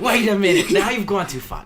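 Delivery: loud, high-pitched outburst; indignant protest. Acted. Co-host defending a favourite actor from critic, indignant. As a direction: cut in and object at full volume.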